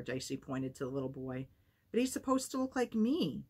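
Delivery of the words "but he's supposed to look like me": high, pleading objection; child objecting to his grandmother; indignant protest. Acted. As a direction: protest like a wronged little boy. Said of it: "Sammy protested".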